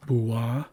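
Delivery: "Bua" said as boo-ah; oo sound. Shown as a demonstration. Teacher repeating the example, "Bua" with oo sound. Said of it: "meant to make an oo sound".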